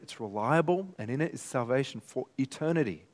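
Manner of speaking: usual speaking voice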